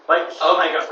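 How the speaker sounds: trying out a Valley voice. Shown as a demonstration. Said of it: "I need to get the voices".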